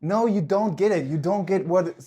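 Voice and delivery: ordinary talk, nothing marked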